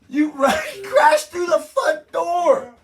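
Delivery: very high, loud, slow outburst; comic outrage at full volume; exasperated shout. Real, not acted. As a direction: shout it through laughter in disbelief. Outraged at the plot.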